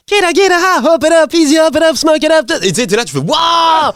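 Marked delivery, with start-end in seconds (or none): 0.1-2.5 very high-pitched mock rap voice; little rapper voice. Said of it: "j'entends une petite voix". 3.2-3.9 high reenacted cry of amazement; amazed wow.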